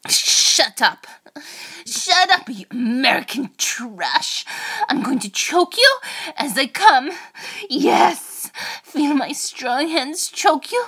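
put-on loud, high, commanding Russian accent; fierce, domineering Russian-accented outburst. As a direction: snap orders in a Russian accent. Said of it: "in a Russian accent".

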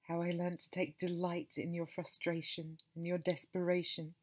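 ordinary delivery, nothing marked

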